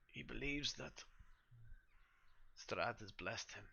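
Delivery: lightly voiced weary priest confessing; troubled priest. Priest on his knees tells the party of his son, troubled. Game master plays grieving priest.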